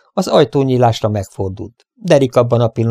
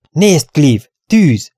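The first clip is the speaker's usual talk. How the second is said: higher and louder, a shocked call; startled cry; 6 semitones higher, 4 dB louder, much slower than usual. Acted. Sheriff calling Cleve to the window, startled shout. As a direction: shout out the fire in alarm. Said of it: "kiáltott fel megdöbbenve".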